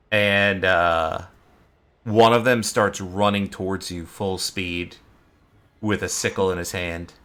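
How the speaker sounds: ordinary talk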